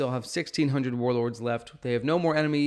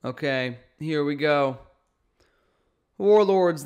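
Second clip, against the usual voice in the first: ordinary delivery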